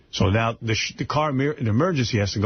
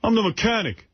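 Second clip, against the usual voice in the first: higher-pitched mock-surly answer; rude attitude; 8 semitones higher than usual. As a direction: imitate a mechanic giving attitude. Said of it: "he's giving her an attitude".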